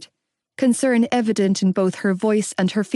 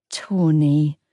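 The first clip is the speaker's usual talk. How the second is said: mother calming her husband with a sigh; 5 semitones lower than usual. A narrator's reading. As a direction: sigh his name gently. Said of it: "Mam sighed".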